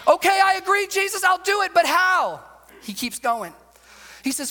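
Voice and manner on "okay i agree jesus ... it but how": exasperated cry. Acted. Listener pushes back on Jesus.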